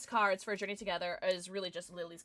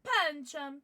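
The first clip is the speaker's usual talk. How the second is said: half-hearted mock Italian accent, higher; badly faked Italian accent; attempted Italian accent; 5 semitones higher, slower than usual. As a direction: draw the names out in a playful Italian accent. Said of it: "do that in an Italian accent".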